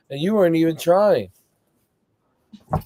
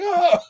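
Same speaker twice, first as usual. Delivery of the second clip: mock shriek; 13 semitones higher than usual. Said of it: "You'll be like".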